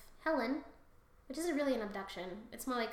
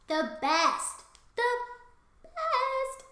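hammed-up flattery; 10 semitones higher, 5 dB louder, much slower than usual. Hyping up in acted flattery.

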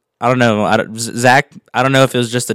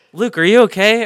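high, louder anxious question; worried call; 9 semitones higher, 6 dB louder than usual. Retold or imitated. Coworker sprinting in, calling out worried. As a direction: rush in alarmed.

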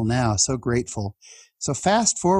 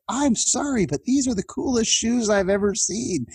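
much higher mock-admiring voice; teasing speaker; 10 semitones higher than usual. Speaker praising teen's shoes before crowd, high mock admiration. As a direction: gush over the shoes in a high playful tone. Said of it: "and he said".

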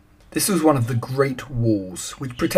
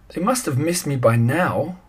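ordinary delivery, nothing marked

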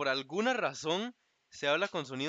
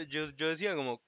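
ordinary talk

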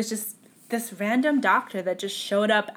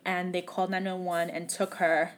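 ordinary talk, nothing marked

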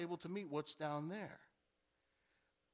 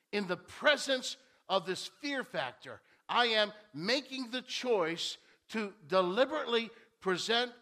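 full-force preaching; 8 dB louder than usual. Acted. Preacher urging the congregation, full-force preaching. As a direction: hammer home the point to the pews.

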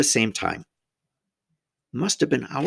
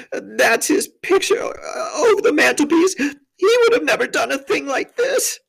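high, loud wailing; hammed-up weepy self-reproach; tearful faun; 6 dB louder than usual. A narrator's reading. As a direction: lament through sobs. Tumnus still sobbing.

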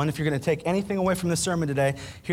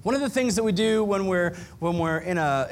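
ordinary delivery

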